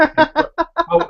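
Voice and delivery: drawn-out high sarcastic oh; mock disbelief. Podcaster mocking fake thanks on Kitchen Nightmares, drawn-out mock oh. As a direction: stretch the oh way up in mock amazement.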